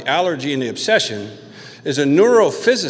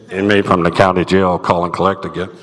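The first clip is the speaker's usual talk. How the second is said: low, louder mock recording voice; recorded operator; 7 semitones lower, 5 dB louder than usual. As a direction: drone it like the jail phone system. Imitating jail collect-call recording.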